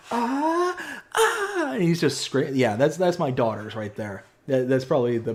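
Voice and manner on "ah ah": very high, hammed-up movie yelp of pain; Ace Ventura scream. Dad mimics a comedy character hit by arrows. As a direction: wail like legs stuck with arrows. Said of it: "He's like".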